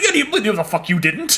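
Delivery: loud, high scoffing comeback; incredulous retort. Retold or imitated. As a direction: call her bluff loudly.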